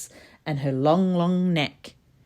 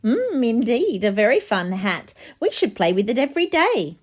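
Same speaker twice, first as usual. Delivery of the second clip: high, lively monkey chatter; playful monkeys crying out, high and bright; cheeky monkeys; 7 semitones higher, 3 dB louder than usual. A narrator's reading. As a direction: tease the piglet with glee. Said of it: "cried the monkeys".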